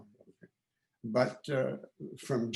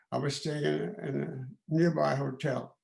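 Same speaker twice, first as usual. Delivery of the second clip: usual speaking voice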